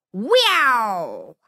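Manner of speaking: snappy whoosh of a whip; whip crack sound. A narrator's reading.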